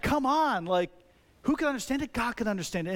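lightly acted bitter objection; bitter exasperation. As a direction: protest with a touch of anger. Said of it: "dealing with some anger here, right? There's some bitterness".

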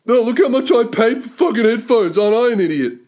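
high, silly mocking voice; hammed-up sarcastic imitation; mocking show-off. Mocking those who show off brands.

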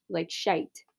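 "shite" said the British way, rhyming with "kite". Shown as a demonstration. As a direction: drawl out "shite" like a Brit. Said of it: "I don't know why I said it like that".

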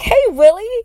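high excited hello; cheery greeting. Retold or imitated. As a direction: greet a friend you're thrilled to see. Calling out greeting to Willie.

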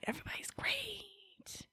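breathy whispered cheer, very soft. Acted. Friends trading cheers, she whispers her part.

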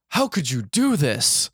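lightly hammed-up reproach; mock anguish. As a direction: act out a wounded protest. Acted accusation in retold game.

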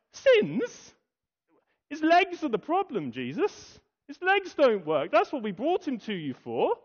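like a friend who can't believe what he just heard; exasperated friends. Acted.

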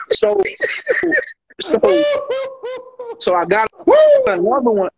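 excited squeal. Real, not acted. Laughing at his own drunk story.

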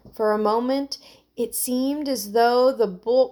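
plain reading voice

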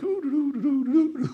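high, light, soft little ditty; carefree "doo doo doo" humming. Acted. Daniel sitting unbothered among lions, high carefree doodling. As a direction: toodle along without a care. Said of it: "they showed Daniel sitting there like".

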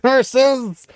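high hammed-up exclamation; mock villain cry. Foiled character cursing, high hammed-up cry. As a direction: spit it out like a cartoon villain, high. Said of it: "And then Arenda's like".

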